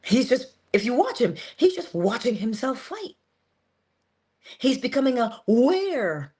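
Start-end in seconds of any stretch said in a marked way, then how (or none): none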